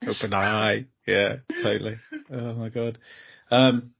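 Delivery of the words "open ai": lightly voiced Ali G impression; a hint of Ali G's accent. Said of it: "like Ali G's".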